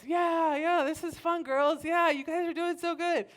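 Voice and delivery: high, loud mock encouragement; forced cheer. Sick babysitter dancing along with girls, forced cheer.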